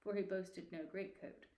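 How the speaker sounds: ordinary delivery, nothing marked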